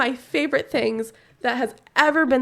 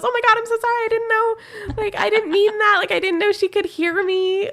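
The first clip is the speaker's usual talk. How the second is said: higher, mock flustered apology; hammed-up embarrassed young man; flustered young guy; 8 semitones higher than usual. Imitating mechanic apologizing once he learns she heard.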